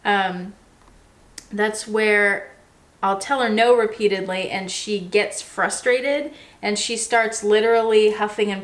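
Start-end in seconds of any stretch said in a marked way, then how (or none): none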